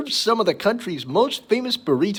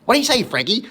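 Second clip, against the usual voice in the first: lizard voice; 6 semitones higher, faster than usual. Retold or imitated. Louie line repeated in ad.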